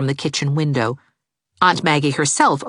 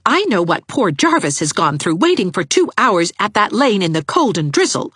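higher, unsparing reproach; no pity for her; merciless; 6 semitones higher, 4 dB louder than usual. A narrator's reading. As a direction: merciless young woman.

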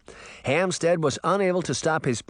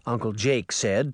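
plain reading voice

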